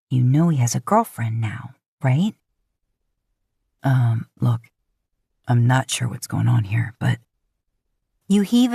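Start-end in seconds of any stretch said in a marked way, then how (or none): 0.1-7.2 a lightly lowered male voice, hesitant; Dean, awkward. A narrator's reading. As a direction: play Dean uneasy, slightly deeper.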